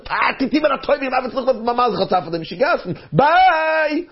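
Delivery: high, rushed excuse and farewell; hurried brush-off. Acted. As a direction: cut them off and dash away, pitched high. Hurried man brushes off the girl.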